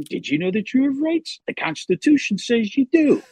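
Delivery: high, slick lawyer pitch voice; fast-talking sleazy lawyer; Saul Goodman. Acted. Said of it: "Saul Goodman".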